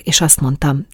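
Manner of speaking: plain reading voice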